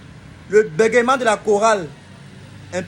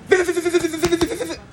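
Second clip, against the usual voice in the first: angry stutter demo; 9 semitones higher than usual. Shown as a demonstration. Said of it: "quand tu es trop fâché".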